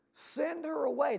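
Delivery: high-pitched mock plea from the disciples; mock urging. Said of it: "they urge Jesus to do what?".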